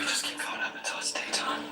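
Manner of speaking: whispering. Real, not acted. Whispering to avoid detection.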